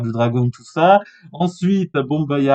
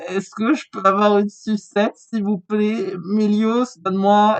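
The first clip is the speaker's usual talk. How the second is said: high-pitched, put-on begging voice; pleading Serra; 7 semitones higher than usual. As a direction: beg in a high little voice. Voices Serra begging Mélios for candy. (voix aiguë et suppliante)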